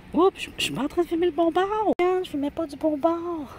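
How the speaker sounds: very high-pitched oops; real startled dismay. Real, not acted. Vlogger realising she filmed the wrong side, startled squeal. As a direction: squeal it out as you catch your mistake.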